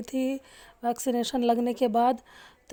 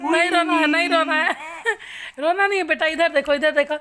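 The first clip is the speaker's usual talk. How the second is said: loud, urgent coaxing; 4 semitones higher, 6 dB louder, faster than usual. Real, not acted. Mother loudly coaxes her crying baby to look over. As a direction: raise your voice to pull her attention.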